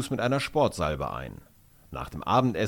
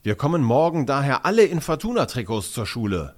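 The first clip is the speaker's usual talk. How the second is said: loud, higher proclamation; eager announcement, voice lifted; announcing; 5 semitones higher, 5 dB louder than usual. A narrator's reading. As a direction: declare it proudly to the group. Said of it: "verkündet Justin".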